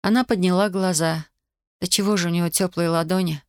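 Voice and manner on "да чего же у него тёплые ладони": ordinary delivery, nothing marked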